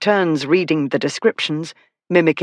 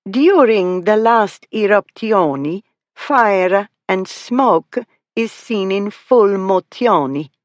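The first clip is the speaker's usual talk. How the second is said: put-on extremely Italian accent; 5 semitones higher, slower than usual. Girl reading the catalogue to her friends, in a hammed-up Italian accent. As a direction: ham up an Italian guide, rolling every R. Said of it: "She rolled the R's and added extra vowels".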